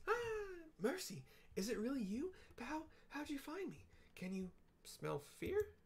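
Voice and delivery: high, breathless relief, slowing at the end; a frightened little man suddenly relieved; relieved Wedge. Acted. Wedge recognizes his rescuer Mercy, high relieved voice.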